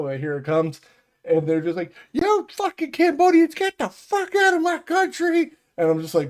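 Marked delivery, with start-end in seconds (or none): 2.1-5.5 high, loud mock-furious rant; hammed-up shrieking outrage; imitated angry yelling. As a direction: yell it like an irate caller, pitched way up. Acts out residents yelling at scammers.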